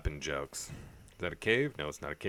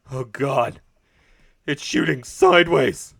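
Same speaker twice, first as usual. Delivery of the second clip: panicked yell; 9 semitones higher, 18 dB louder than usual. Real, not acted. Panic at splitting shooting star.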